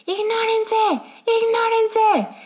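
imitating mom's high, drawn-out mock yell. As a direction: stretch it out high like an exasperated mother.

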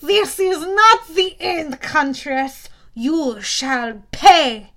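slow, loud, vengeful wail; the wounded Manticore cursing the Huntress; wailing threat. A narrator's reading. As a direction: drag out the parting curse.

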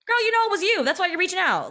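high, loud sassy clapback. Retold or imitated. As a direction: call them out, high and sassy.

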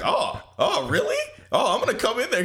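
high, eager mock voice; dumb excited Goku. Goku hearing about chocolate, high mock eagerness. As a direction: gullible hero gets excited.

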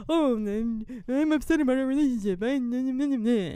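whiny put-on boyfriend voice dissolving into gibberish; mocking whine. Co-host ridiculing the boyfriend's complaint, mocking whine. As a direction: mimic his complaint as petulant babble.

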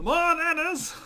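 excited shout. Acted. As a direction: yell it like a greedy crew chant.

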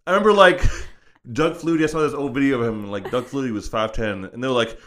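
ordinary talk, nothing marked

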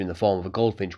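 usual speaking voice

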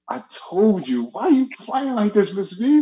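high pleading student whine; whiny complaint. Retold or imitated. Julius pleads with Miss V, high and whiny. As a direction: complain like a wronged kid.

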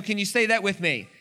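normal talking voice

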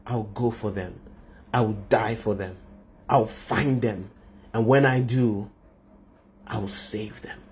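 low, soft, measured movie-hero voice; dramatic hero vow. Acted. The Son vows to God to save people, hushed dramatic vow. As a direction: pause between vows, sinking to a whisper. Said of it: "I know it sounds dramatic".

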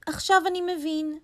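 high little rabbit conceding. A narrator's reading. Rabbit admits lion is right, high rabbit voice.

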